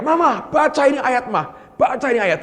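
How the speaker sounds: mild mock shout of a child; child calling out. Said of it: "dia teriak-teriak".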